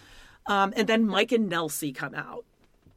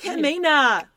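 mock snap; 7 semitones higher, 6 dB louder, slower than usual. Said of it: "she, like, snapped".

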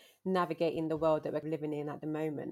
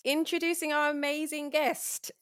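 hype announcer; 11 semitones higher, 6 dB louder, slower than usual. Real, not acted. Hyping the guest.

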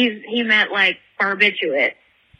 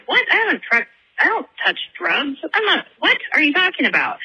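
higher-pitched, startled denial; indignant bear protest; 7 semitones higher than usual. Acted. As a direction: act out an affronted bear, voice jumping up. Bear protests drug accusation in joke.

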